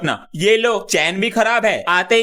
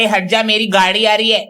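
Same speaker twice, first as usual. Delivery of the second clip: ordinary talk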